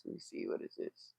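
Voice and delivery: hushed, puzzled whisper to herself; whispered aside. Real, not acted. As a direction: whisper to yourself at the screen. Muttering to herself at controls.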